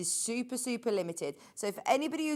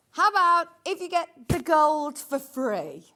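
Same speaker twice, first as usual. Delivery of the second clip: loud, high sales pitch; excited; 5 semitones higher, 6 dB louder than usual. Real, not acted. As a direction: call it out with a grin. Presenter pitching a freebie.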